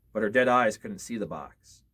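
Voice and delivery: plain reading voice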